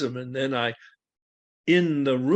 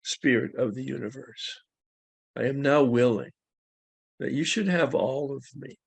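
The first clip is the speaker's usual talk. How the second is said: spoken prayer. Acted. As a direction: pray it aloud for the room. Leads the group in prayer.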